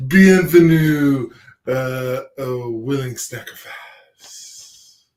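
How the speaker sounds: slow, hammy French host voice; bad French accent. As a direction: drawl it like a fake Frenchman. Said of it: "That is the worst French accent I've ever heard".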